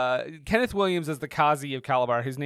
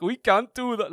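crowd guy's mouth-pulled-back voice, a bit higher; mouth held back; 5 semitones higher than usual. Retold or imitated. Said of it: "holding his mouth back and doing the whole thing".

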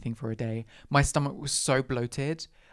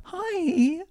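sickly sweet mock politeness, high; sugary fake politeness with a bite; fake-polite sarcasm; 7 semitones higher, 4 dB louder than usual. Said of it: "I was so close to walking over to her".

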